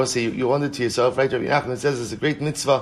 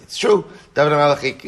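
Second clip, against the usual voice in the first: a sudden, much higher, louder burst on "it's true"; emphatic; 4 semitones higher, 6 dB louder than usual. Real, not acted. Teacher conceding a point to the class, with a sharp emphatic burst. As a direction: punch the concession hard.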